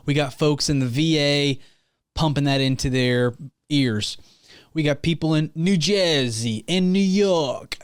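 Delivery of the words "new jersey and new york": hammed-up New Jersey and New York accent; drawn-out mock New York-New Jersey accent; bad New Jersey accent. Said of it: "That's not a good accent".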